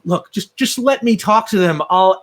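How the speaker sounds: high, loud desperate plea; pleading captive. Acted. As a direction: plead urgently as a tortured prisoner. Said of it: "And, and he's like".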